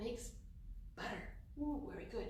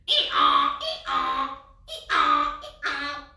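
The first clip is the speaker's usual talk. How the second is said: hee-haw brayed much louder; loud donkey bray; 3 semitones higher, 14 dB louder, much slower than usual. Shown as a demonstration. Showing the kids the donkey's sound, loud braying. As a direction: bray like a donkey, full blast. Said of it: "that donkey makes such a loud noise".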